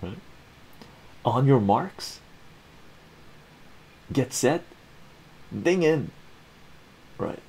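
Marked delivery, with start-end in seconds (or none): 1.2-6.1 rising, higher countdown call; mock race start. Vlogger calls the family to dinner like a race start.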